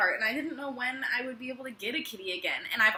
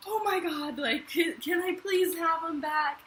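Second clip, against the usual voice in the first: pleading woman; 7 semitones higher than usual. Retold or imitated. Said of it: "she was just like".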